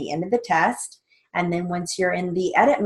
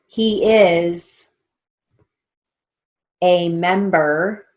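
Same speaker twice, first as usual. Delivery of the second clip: slow, deliberate dictation read with pauses; 5 dB louder, much slower than usual. Shown as a demonstration. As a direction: dictate each word slowly and clearly for the class. Dictation to court reporting students.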